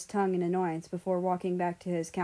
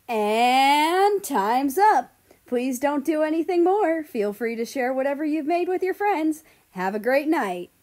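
host announcement; 7 semitones higher, 9 dB louder than usual. A narrator's reading. Host wraps up the cooking round, loud and bright. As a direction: call time like a TV host.

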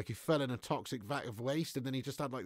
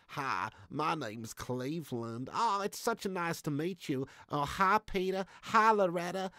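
slow drawl, rising higher as it goes; Cleveland; 5 semitones higher, slower than usual. Acted. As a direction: imitate a mild-mannered cartoon neighbour. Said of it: "But, yeah, Cleveland".

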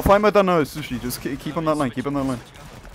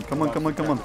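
usual speaking voice

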